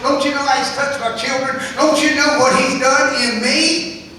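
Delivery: loud, high taunting of the devil; scornful defiance. Pretend. Preacher taunting the devil for the church, loud and scornful. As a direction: throw it in the devil's face.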